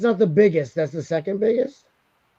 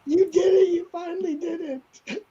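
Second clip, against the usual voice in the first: mock tearful pride; 13 semitones higher than usual. Mock proud, crying.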